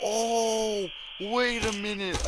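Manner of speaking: usual speaking voice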